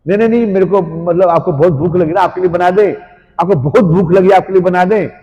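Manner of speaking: high, loud repeated urging; pushy insistence. Acted. Devotee forcing parathas on a reluctant friend, insistent. Acting out the wrong, pushy offer.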